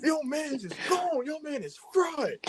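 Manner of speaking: high, slow, teasing call; mock teasing shout. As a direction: yell it out to embarrass him. Friend calling out on FaceTime.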